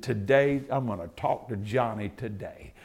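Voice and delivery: low, soft self-talk, a quiet vow; psyching himself up under his breath; muttered resolve. Retold or imitated. Barber to himself, quiet muttered resolve. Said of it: "And he said".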